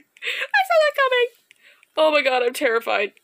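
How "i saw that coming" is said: high taunt. Real, not acted. Mock sing-song repeat.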